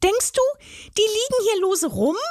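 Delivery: very high, sarcastic fairy voice; tiny fairy mocking a dim troll; scolding fairy. A narrator's reading. Fairy mocks the troll's question, high and sarcastic. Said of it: "schimpfte die zarte Stimme".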